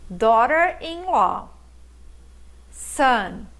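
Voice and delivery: slow, careful word-by-word model for students; listen and repeat drill. Shown as a demonstration. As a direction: say each word clearly and leave a gap to repeat. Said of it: "Listen and repeat". (leitura pausada para o aluno repetir)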